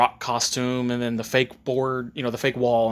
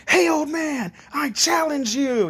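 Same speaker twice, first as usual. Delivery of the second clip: put-on high, drawn-out kid's challenge; bratty challenge; 13 semitones higher, slower than usual. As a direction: call out a cocky challenge, stretched and high. Boy bursts in, challenges old man.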